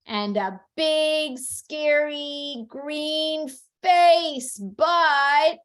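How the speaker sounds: storybook reading with loud, lingering emphasis; dramatic picture-book reading. Acted. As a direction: draw out each word for a small child. Mom reads the book aloud.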